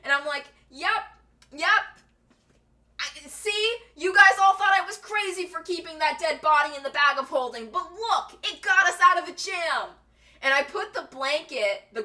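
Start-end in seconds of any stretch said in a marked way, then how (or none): 0.7-9.9 raised, louder gloating to the party; smug told-you-so. Retold or imitated. Gloating to the party who doubted the corpse bag, smugly. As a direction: rub it in that you were right.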